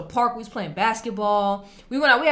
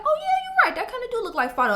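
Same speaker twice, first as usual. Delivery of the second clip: mock sudden agreement; 13 semitones higher, much faster than usual. Herself agreeing, retold.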